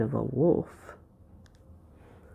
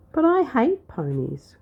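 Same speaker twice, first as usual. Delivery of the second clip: higher, louder complaint; cried out; 9 semitones higher, 4 dB louder than usual.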